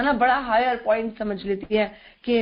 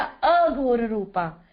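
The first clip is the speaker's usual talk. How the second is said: slow "Aghor Rupa" with the opening अ held long; added अ drawn out; much slower than usual. Shown as a demonstration. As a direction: stretch the अ at the start of Aghor. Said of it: "आ का डंडा इधर हटा के आगे लगा दिया जाए".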